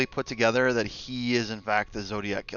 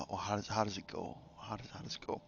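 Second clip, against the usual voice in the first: softly muttering, searching memory; thinking aloud under breath; muttering to self; 11 dB quieter, faster than usual. Real, not acted. Muttering to self, trying to recall.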